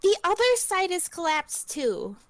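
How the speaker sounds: put-on high, bright diva girl. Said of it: "I kinda wanna try to do a diva voice".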